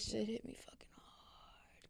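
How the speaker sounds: hushed, breathless whisper of grief; whispered, barely voiced; real whisper. Real, not acted. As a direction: let it slip out in a whisper, slowly. Recalling grandma's death, whispering.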